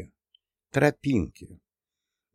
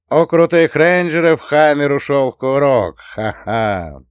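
raised, loud mocking call; jeering taunt; 5 semitones higher, 6 dB louder than usual. A narrator's reading. Someone taunts the rangers, loudly jeering. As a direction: jeer at the enemy, then a short ha-ha. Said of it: "услыхали издёвку".

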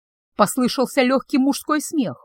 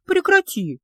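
sharp, much higher outburst; indignant; 9 semitones higher, slower than usual. Acted. Witch scolds the staring stranger, high indignant cry. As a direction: snap at him to stop. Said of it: "возмутилась".

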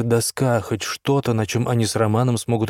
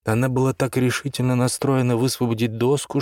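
ordinary delivery

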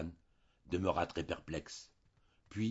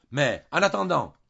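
exasperated outburst, much higher and louder; irritated; 9 semitones higher, 13 dB louder than usual. A narrator's reading. Red-faced husband interrupting his wife, irritated. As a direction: snap back impatiently. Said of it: "s'irritait encore".

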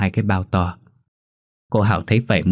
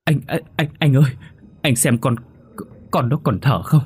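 stammered, then high, loud wailing; stammering panic into wailing; 9 semitones higher, 3 dB louder than usual. A narrator's reading. Mother realising her son is dead, stammering then wailing. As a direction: stutter in terror, then break into a wail. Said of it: "Cô Hảo lắp bắp gọi chú Huấn".

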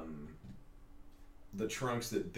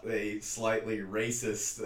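normal talking voice

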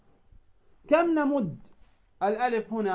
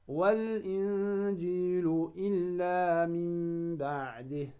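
slow chanted recitation; recited with two-beat madd; 4 dB quieter, much slower than usual. Shown as a demonstration. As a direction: recite slowly, holding the madd two counts. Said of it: "فتقول".

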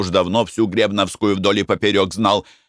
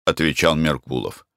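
ordinary delivery, nothing marked

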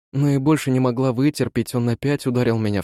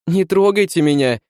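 high, louder defensive cry; alarmed cry; 7 semitones higher, 5 dB louder than usual. A narrator's reading. Frightened woman wards off the man, crying out high and loud. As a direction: cry out as if fending off an attack. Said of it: "вскрикнула она и протянула вперёд руки".

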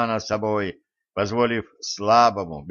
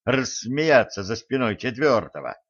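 ordinary delivery

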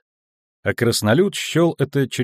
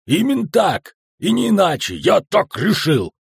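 высокий возбуждённый выкрик; крик; 9 semitones higher, 4 dB louder than usual. A narrator's reading. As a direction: shout it out high, insisting on every phrase. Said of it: "который кричал". (возбуждённо, громко кричит)

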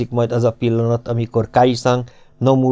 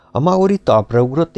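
ordinary delivery

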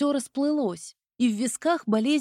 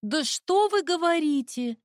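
higher, biting sarcastic exclaim; spiteful sarcasm; 6 semitones higher than usual. A narrator's reading. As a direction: fling it back with nasty sarcasm. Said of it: "воскликнула со злым ехидством".

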